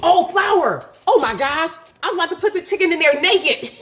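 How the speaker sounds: gasping, high and loud; sudden alarmed realisation; startled gasp. Real, not acted. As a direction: caught off guard, yelp it out. Startled gasp while frying.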